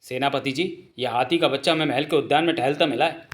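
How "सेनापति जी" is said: usual speaking voice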